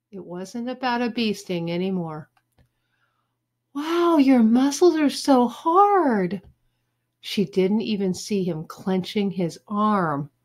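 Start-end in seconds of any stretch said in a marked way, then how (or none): 3.7-6.4 high, slow gushing; hammed-up swooning admiration; admiring girl. A narrator's reading. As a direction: marvel in a drawn-out girlish voice. Judy admiring Joey's muscles.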